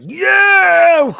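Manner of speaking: high loud celebratory yell; sudden high whoop of relief; excited cheer. Retold or imitated. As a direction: shout for joy at the TV. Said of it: "I'm like".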